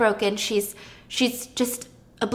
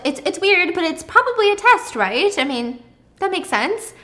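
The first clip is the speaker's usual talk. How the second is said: higher-pitched, puzzled voice; puzzled self-reassurance; 8 semitones higher, 5 dB louder than usual. Acted. Julia reasoning over a strange printout, puzzled. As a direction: talk yourself out of a mild worry.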